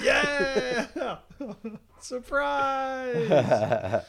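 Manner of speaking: high, loud, drawn-out celebratory squeal; hammed-up excited shriek; mock surprise cheer. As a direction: cheer as if revealing a big surprise. Mock celebration of co-host's spoiler.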